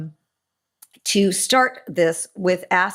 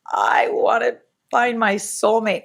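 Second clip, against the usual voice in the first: higher-pitched, sheepish confession of a wish; apologetic; 5 semitones higher than usual. Retold or imitated. Telling the group about wanting a soulmate, apologetically. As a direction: say it as if wanting it were wrong. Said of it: "I said it very, uh, mm, apologetically".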